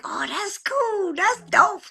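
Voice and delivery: lightly voiced fans, pitched high; high, admiring mock quote; imitated young fans. Quoting young people who saw it, in a high imitated voice. Voicing young fans' reaction.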